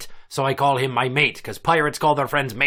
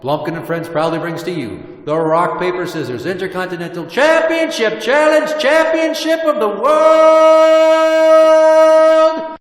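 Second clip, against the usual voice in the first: high, loud booming announcer voice; ring announcer; 9 semitones higher, 8 dB louder than usual. Acted. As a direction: build it like a boxing announcer. Announces championship.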